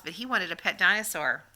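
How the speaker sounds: ordinary delivery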